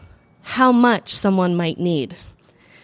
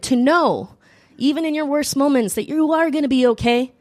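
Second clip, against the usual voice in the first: ordinary talk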